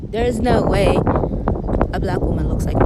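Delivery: ordinary talk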